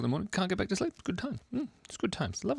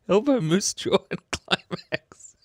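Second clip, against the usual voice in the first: squeaky high voice; 10 semitones higher, 3 dB louder, slower than usual. Pretend.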